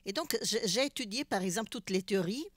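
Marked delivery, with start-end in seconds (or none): none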